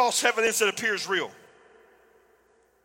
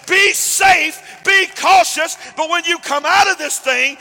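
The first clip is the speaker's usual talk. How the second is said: preaching; 8 semitones higher, 9 dB louder than usual. Real, not acted. Preaching to the congregation.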